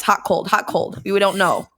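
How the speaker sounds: ordinary delivery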